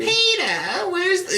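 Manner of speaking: lightly voiced high Lois; a hint of Lois calling Peter. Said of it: "doing Family Guy voices".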